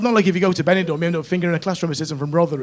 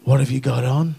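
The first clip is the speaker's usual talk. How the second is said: imitated Debs's voice; 5 dB louder, much slower than usual. Debs asks Colin what he has on, hammed-up flirtation. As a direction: ham up the suggestive caller. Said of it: "And she went".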